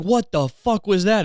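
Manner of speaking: high-pitched, drawn-out mock yell; stretched, exasperated retold outburst. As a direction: re-enact yelling at a driver, without real volume. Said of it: "is what I yelled".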